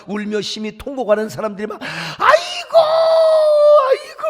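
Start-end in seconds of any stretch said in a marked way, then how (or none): 1.8-4.2 high, loud mock wail. Preacher imitating funeral mourners wailing, high and loud.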